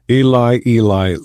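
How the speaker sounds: understated crying out, as in scripture reading; loud cry. A narrator's reading. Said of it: "cried with a loud voice".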